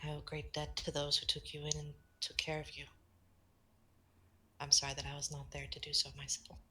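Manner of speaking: soft kind voice fading to a whisper; gentle whisper. Acted. Eve greeting shy Maris, gently whispered. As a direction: welcome the little one tenderly.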